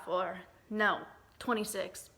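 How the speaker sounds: normal talking voice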